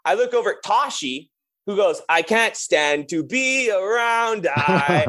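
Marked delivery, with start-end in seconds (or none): 2.1-5.1 sung slowly in a mock Japanese-British accent; higher, drawn-out hook with imitated Japanese-British vowels; Toshi's half-Japanese, half-British accent. Drummer imitating his drum tech singing along, mock Japanese-British accent. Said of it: "he has this weird accent".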